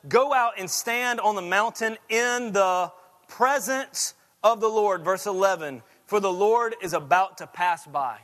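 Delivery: emphatic pulpit reading of scripture; preaching at full force. Acted. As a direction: press the story home. Preaching, reading Elijah story.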